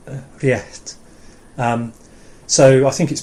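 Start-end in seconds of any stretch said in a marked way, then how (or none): 0.1-1.0 réirt with a puff of breath before the t. Shown as a demonstration. Teacher demonstrates the breathy t in réirt. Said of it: "they just breathe out".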